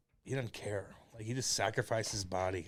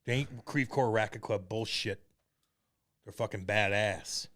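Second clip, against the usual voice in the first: ordinary talk, nothing marked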